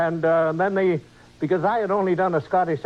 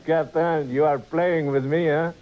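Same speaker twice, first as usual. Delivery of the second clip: comic French engineer with a chuckle; chuckle, then tease in a French accent; 3 semitones lower than usual. Acted. Engineer teases his captain, French accent. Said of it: "they picked a different accent".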